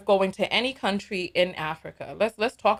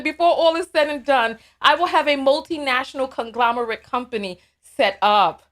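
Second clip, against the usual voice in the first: ordinary delivery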